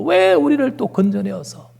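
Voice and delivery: high-pitched mock grumbling; faithless complaint. Preacher voicing Israelites who resent being rescued, complaining. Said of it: "불신앙의 끝판이 막 나와요".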